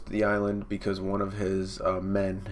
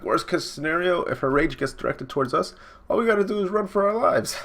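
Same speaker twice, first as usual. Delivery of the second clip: high comic sidekick building higher; pitched-up comic voice; joking crewman; 9 semitones higher than usual. Acted. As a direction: climb into a squeal on the punchline. Crew voiced joking about fleeing.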